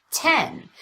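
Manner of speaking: Ten said briskly, high then falling; quick Ten, keeping the pitch drop. Shown as a demonstration. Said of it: "Even when I speed it up".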